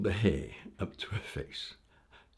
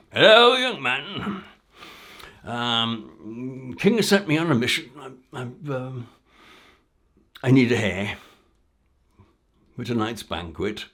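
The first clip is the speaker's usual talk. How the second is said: pompous gamekeeper; 4 semitones higher, 9 dB louder than usual. Acted. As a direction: announce grandly like a royal gamekeeper.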